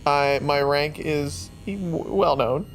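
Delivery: ordinary delivery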